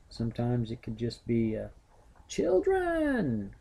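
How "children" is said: put-on "Children!" called out much higher, like an exclamation; exclaimed call. Shown as a demonstration. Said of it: "with an exclamation point".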